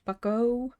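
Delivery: normal talking voice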